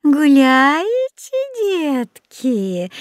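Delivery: put-on high, slow sugary old woman; sweet voice. A narrator's reading. Stranger questions the children at her hut, sweet voice. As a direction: coo at the children, dragging it out. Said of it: "сладким голосом поинтересовалась незнакомка".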